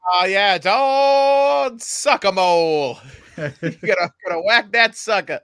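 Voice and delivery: loud, laughing carnival barker call; laughing ringmaster. Acted. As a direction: bark it out through laughter.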